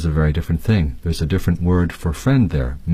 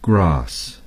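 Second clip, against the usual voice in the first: British English grass, with a long open ah; British pronunciation of grass. Shown as a demonstration. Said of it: "The British would say".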